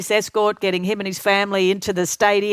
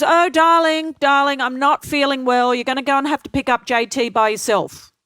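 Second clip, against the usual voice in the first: higher, louder, theatrical darling voice; Ken's fussing voice; 5 semitones higher, 3 dB louder than usual. Retold or imitated. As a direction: camp it up as a fussing colleague. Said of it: "Ken goes".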